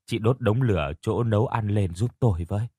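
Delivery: plain reading voice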